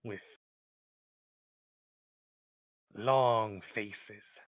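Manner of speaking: loud, slow kobold with heavy pauses; distrustful kobold. Acted. Kobold describes the outcast to the adventurers, loud distrust. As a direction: drop each damning description separately and forcefully.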